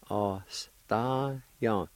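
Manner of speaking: "Ostaja" drawn out very slowly, each vowel held; slow demonstration. Shown as a demonstration. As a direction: model "Ostaja" at half speed for learners. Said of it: "And together".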